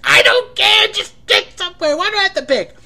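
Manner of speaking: high, loud, fed-up cry; mock exasperated yell, high and loud; exasperated outburst. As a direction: throw up your hands and yell in mock despair. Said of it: "you're pulling out your hair like".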